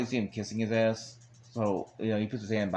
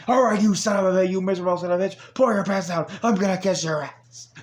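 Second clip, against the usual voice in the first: high, loud unhinged rant; loud manic imitation of a crazed wrestler; crazy voice; 8 semitones higher, 5 dB louder than usual. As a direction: go wild-eyed and manic. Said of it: "in this crazy voice".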